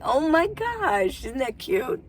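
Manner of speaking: slow, high, drawn-out oh my gosh; delighted gush. Real, not acted. Vlogger gushing to viewers about her visitor, delighted. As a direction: stretch it out, charmed.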